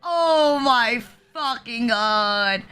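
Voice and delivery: drawn out, slow, disbelieving; stunned slow exclamation. Real, not acted. Streamer reacting to the comic punchline, slow and stunned. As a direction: stretch it out in shock.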